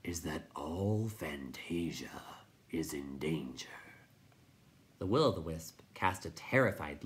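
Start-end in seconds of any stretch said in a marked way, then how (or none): 0.0-3.7 low, slow grinding voice; grating rock voice. A narrator's reading. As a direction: grind the words out like stone. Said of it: "grated the rock chewer".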